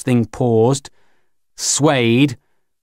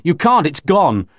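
defiant; 7 semitones higher than usual. A narrator's reading. Mac defies Grinny.

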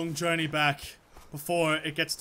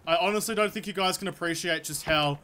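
ordinary delivery

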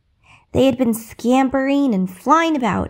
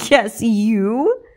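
mocking, sneering question; a touch of mockery, other voice. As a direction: scoff at her. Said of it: "mocked the stepmother".

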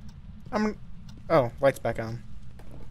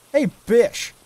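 taunting; 6 semitones higher, 5 dB louder, much slower than usual. Acted. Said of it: "this is how we need to confront".

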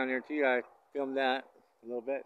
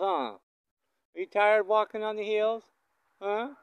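higher, sing-song voice for the cows; pet talk; 8 semitones higher, 4 dB louder than usual. Real, not acted. Rancher chatting to cows and calves, higher sing-song. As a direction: coo at them like pets.

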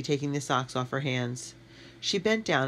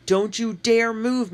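slow, loud warning call, much higher; 9 semitones higher, 7 dB louder, slower than usual. A narrator's reading. Matt calls to Katie to stay put, loud and slow. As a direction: hold her still with your voice. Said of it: "Matt called".

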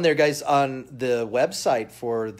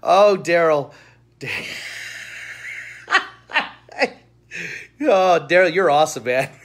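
louder, laughing affection; amused; 4 semitones higher, 7 dB louder than usual. Real, not acted. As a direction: call out to Daryl through laughter. Laughing at viewer Daryl's joke.

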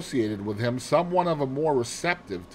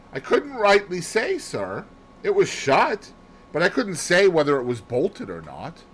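put-on higher-pitched servant girl, rising phrases; hesitant maid Annie; 7 semitones higher, 3 dB louder than usual. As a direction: answer unsurely but earnestly as a maid.

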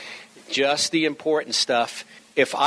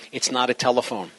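ordinary delivery

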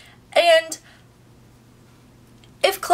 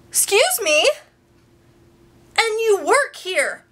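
indignant disbelief; 6 semitones higher than usual. Retold or imitated. Retelling a rude store worker, indignant high disbelief. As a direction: act out being appalled at the clerk.

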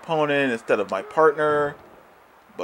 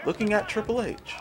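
softer, higher-pitched grumble; deflated complaint; 7 semitones higher, 6 dB quieter than usual. Real, not acted. Gamer grumbling about his wrong target, softer deflated complaint. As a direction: repeat it softly in disbelief. Same words, muttered after yelling.

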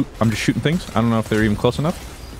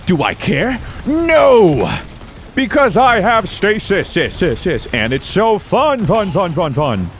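louder, higher gleeful rant; hyped shout; 6 semitones higher, 4 dB louder, slower than usual. Real, not acted. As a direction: bounce off the walls with joy. Hyped rant about stasis.

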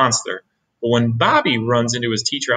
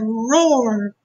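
mild bear roar; 9 semitones higher than usual. A narrator's reading. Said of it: "the bear said".

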